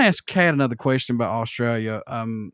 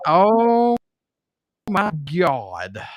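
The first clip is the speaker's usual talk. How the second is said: Southern drawl; 4 semitones higher, much slower than usual. Acted. Joining the put-on Southern voice.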